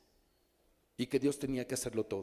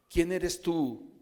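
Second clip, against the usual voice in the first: very slow, louder, higher, each word weighed; slow challenge; 5 semitones higher, 4 dB louder, much slower than usual. Acted. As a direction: ask it slowly like a challenge. Declara el versículo al monte. (pregunta lenta y desafiante)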